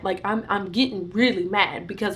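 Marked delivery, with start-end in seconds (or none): none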